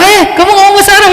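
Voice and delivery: high, fast, joyful cry of recognition; delighted laughing Egyptian. Retold or imitated. Egyptian man, delighted the preacher speaks Arabic, high excited exclaim. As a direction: burst out happily like meeting a brother. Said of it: "Ya udah ketawa".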